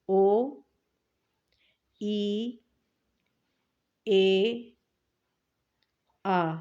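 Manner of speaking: او، ای، اے، آ each held long and clear; vowel sounds demo. Shown as a demonstration. Teacher reading the vowel row aloud to pupils. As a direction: draw out every vowel slowly. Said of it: "ہم اس کو یہاں سے پڑھتے ہیں".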